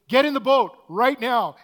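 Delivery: loud, high, barked order; urgent shout. Retold or imitated. Boat guy shouting at Peter to board now, loud and urgent. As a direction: yell it like there's no time to lose.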